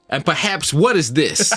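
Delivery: high, put-on celebrity host voice. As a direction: quote the video with mock grandeur. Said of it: "is that he goes".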